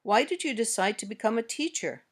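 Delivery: "teacher" falls to close the question; falling intonation. Shown as a demonstration. Teacher gives learners a wh-question to repeat, falling intonation. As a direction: let the voice go down on "teacher". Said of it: "Try these examples".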